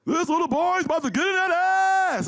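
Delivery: high, loud announcer boom; hammed-up boxing intro; mock ring announcer. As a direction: call it like a fight is starting. Mock fight announcer call.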